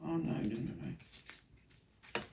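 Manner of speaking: real sinking dismay under the breath; dismayed whisper. Real, not acted. Vlogger realises she forgot the double cut, dismayed whisper.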